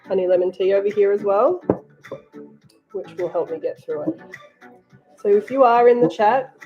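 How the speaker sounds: ordinary talk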